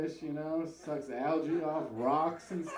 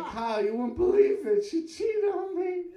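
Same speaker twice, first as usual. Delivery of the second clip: high, wailing mock sob, louder; hammed-up blubbering to a friend; crying on the phone; 13 semitones higher, 6 dB louder than usual. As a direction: wail it like a heartbroken kid on the phone. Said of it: "I called him crying".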